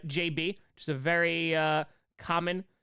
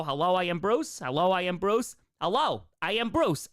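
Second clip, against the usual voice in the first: ordinary delivery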